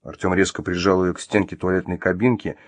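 plain reading voice

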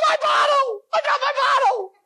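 very high squealed scream of joy; ecstatic screaming. Acted. Man alone celebrating his windfall, ecstatic screaming. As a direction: shriek it high, overjoyed. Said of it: "What is he screaming?".

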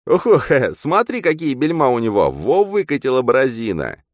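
high, loud, excited character voice; high-pitched sidekick outburst; jeering double. Acted. Double mocking the medium.

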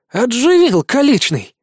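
amazed high exclamation; surprised. A narrator's reading. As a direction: blurt it out in disbelief. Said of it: "удивлённо уставился Никитка".

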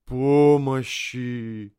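very slow, high, warped plea; eldritch horror. Acted. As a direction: drag out a monstrous cry for help. Mutating friend cries for help.

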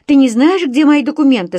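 loud, high-pitched demand without a greeting; crying out. A narrator's reading. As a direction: cry out the question in alarm. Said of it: "закричала я".

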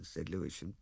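ordinary delivery

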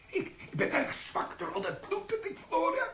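feigned weakness. Pretend. Sergeant fakes weakness before police.

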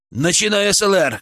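high, urgent command; shouted order. A narrator's reading. Medic starts resuscitation, shouting the order. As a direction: launch the team into action. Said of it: "крикнул я".

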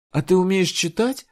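high, loud surprised question. Acted. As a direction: ask in disbelief. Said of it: "удивилась девочка". (удивлённо)

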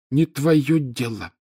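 slow, cutting put-down; curt retort. A narrator's reading. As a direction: cut him off coldly. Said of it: "отрезала Миртл".

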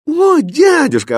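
high, loud cry of joy; delighted. A narrator's reading. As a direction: exclaim happily on seeing your uncle. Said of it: "обрадовался Аладдин".

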